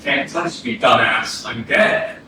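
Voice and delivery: low hushed taunt. Acted. Ghost taunting his living brother.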